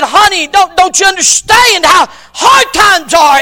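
loud, high exasperated shout at his daughter; frustrated father. Retold or imitated. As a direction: snap at the child out of strain. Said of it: "in his frustration".

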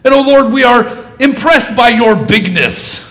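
Monty Python style overblown prayer; mock pompous prayer. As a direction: boom it like a parody prayer. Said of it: "an old Monty Python skit".

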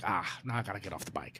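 low, very soft muttered thought; hushed, low grumble; resigned aside. Retold or imitated. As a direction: mutter it to yourself, reluctantly. Retold weary thought to himself.

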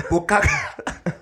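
high, slow fumbled "Bukaka". Retold or imitated. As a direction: stretch out "Bukaka" like a clueless professor butchering it.

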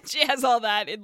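ordinary delivery

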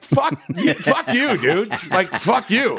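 playful mock indignation at a fan; laughing mock outrage. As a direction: fire it back through a laugh. Joking back at a roasting fan.

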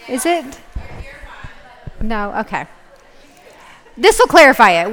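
normal talking voice

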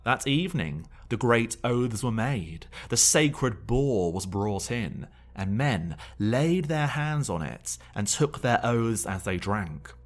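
loud, solemn saga telling; story narration. Acted. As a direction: read it like an epic legend. Dramatic saga reading over music.

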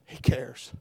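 low, near-whispered; hushed assurance. Real, not acted. Preacher comforting those weeping themselves to sleep, hushed assurance. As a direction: drop to a soft, tender murmur.

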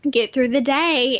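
usual speaking voice

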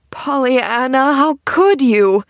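slow, drawn-out distressed moan; moaning reproach. A narrator's reading. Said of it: "moaned the woman".

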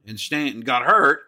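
usual speaking voice

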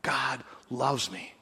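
slow and soft, a dying man in wonder; quiet awe. Retold or imitated. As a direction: breathe it out slowly, amazed. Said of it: "And the guy goes".

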